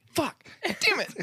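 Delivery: sharp high-pitched swear of frustration; frustrated curse. Acted. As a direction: curse it out in sudden exasperation. Computer refuses, frustrated outburst.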